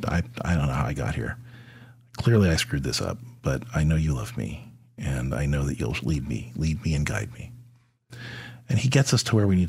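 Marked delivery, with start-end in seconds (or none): 0.1-7.5 hushed humble prayer. Retold or imitated. Humble prayer quoted to God.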